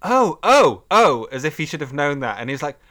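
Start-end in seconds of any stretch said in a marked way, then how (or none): 0.0-1.2 louder, higher realisation; shocked professor. Retold or imitated. Professor stops in class, louder, higher, caught out. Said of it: "He's like".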